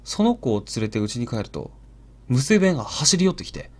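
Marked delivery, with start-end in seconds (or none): none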